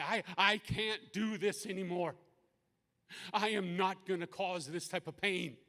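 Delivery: anguished refusal. Retold or imitated. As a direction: re-enact a father's distressed protest. Father refuses to cause pain.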